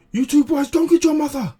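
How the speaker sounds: high, loud barked order; urgent shout. Retold or imitated. Vegeta ordering the boys to fetch Bulma, shouting high. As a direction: bellow at the boys to run for help. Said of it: "Vegeta's like".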